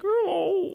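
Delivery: high imitated cartoon dog wail; high distressed wail. Co-host imitating Courage with the puppets, high wail.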